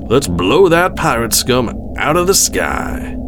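admiral's drawn-out war cry; shouted battle order. A narrator's reading. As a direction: rouse the bridge crew for battle. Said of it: "he shouted".

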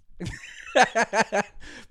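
light high laughter. Real, not acted. Laughs after the poem.

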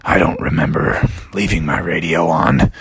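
low, puzzled monster voice; deep dragon grumble. Acted. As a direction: rumble it like a sleepy dragon. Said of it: "the dragon could recognize that voice".

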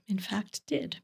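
whispered, guilty admission; sheepish whisper. Acted. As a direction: drop to a whisper to confess. Joking confession about chicken fingers.

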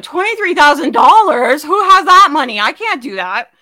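loud mock panic over the price; panicked disbelief. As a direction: throw up your hands, shocked at the cost. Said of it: "I coulda just went".

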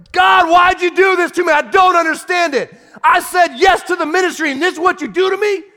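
high, loud, raging complaint to God; hurt believer yelling up at God; angry prayer. Acted. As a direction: shout your grievance at God.

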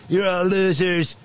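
high, drawn-out mock screaming; hammed-up screamed tantrum; Nitwit Nero. Boss berating his advisers, mock high scream, drawn out. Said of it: "Nitwit Nero started screaming".

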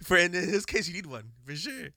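usual speaking voice

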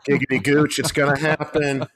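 gleeful excitement. Retold or imitated. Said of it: "You're like".

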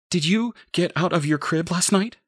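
high, anxious question; alarmed questioning. A narrator's reading. Mother questions baby about last night, high alarmed voice.

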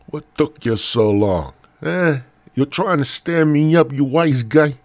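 high, loud tough-guy complaint; wise-guy scolding. Acted. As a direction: put on an indignant wise-guy voice. Acted wise-guy scolding, repeated question.

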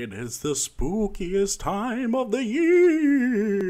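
high, slow, loud wailing ghost. Acted. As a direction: moan it out like a horse ghost. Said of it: "That's my impression of a ghost who is also a horse".

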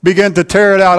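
fervent pulpit preaching; full-force preaching. Acted. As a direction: preach it out to the congregation.

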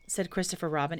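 plain reading voice

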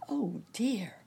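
high-pitched fretting; dismayed cry. A narrator's reading. Nurse Jane worried by Wiggily's blues, crying out in dismay. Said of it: "cried Nurse Jane".

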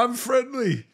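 high-pitched talking-to-a-cat voice; friendly coaxing. Real, not acted. Guest calling to a cat, high coaxing voice.

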